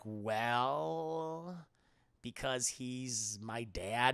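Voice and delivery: low, drawn-out admission; stall, then let it out slowly; acted reluctant confession. Luke confessing his parentage to Leia, reluctant.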